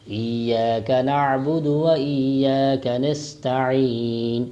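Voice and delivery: slow chanted ایاک نعبد و ایاک نستعین; tajweed recitation; careful recitation. Shown as a demonstration. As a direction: recite slowly for students to copy. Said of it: "غور سے سنیں".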